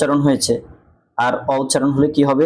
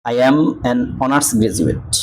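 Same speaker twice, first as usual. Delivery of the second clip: slow demo reading; much slower than usual. Shown as a demonstration. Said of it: "অ উচ্চারণ হয়েছে".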